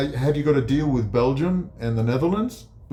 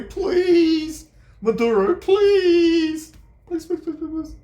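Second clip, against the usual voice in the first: mock pleading; 3 dB louder, much slower than usual. Mocking terrified leaders begging, high whine. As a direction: beg pathetically, dying away. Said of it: "They're terrified".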